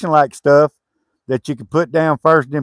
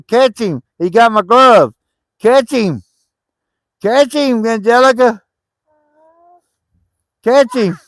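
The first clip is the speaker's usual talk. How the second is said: urging to catch; 7 semitones higher than usual. Real, not acted. Urging someone to catch dog.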